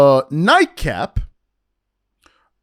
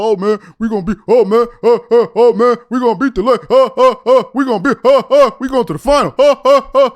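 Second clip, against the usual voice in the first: louder, higher stammering excited rant; mock stammering Shannon; 4 semitones higher, 6 dB louder, faster than usual. Mocking Shannon Sharpe's rant, loud hammed-up stammering. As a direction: ham up a flustered pundit stuttering with excitement. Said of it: "Shannon Sharpe was talking about".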